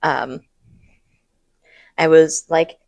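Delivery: ordinary talk, nothing marked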